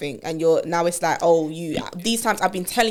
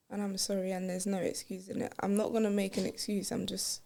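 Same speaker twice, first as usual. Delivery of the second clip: soft, guilty apology; hushed apology; 16 dB quieter than usual. Acted. Quiet apology.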